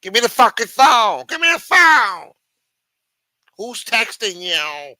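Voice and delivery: high, very loud couple's-fight shout; acted quarrel yelling. Man fighting over the phone with his chick, high mock-furious yelling. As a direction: yell it like a jealous row over a phone. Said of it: "was fighting over the phone with his chick".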